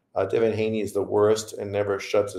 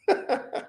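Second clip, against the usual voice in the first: quick mocking laugh, high; a brief scornful chuckle; real scoffing laugh; short laugh; 4 dB quieter than usual. Real, not acted. Mocks the fans he quotes.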